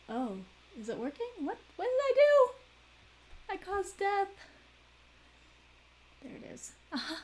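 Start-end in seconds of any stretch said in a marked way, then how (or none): 1.4-4.3 high, loud, slow what-did-I-do; real startled alarm. Real, not acted. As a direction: caught off guard, much higher and louder. Game surprise.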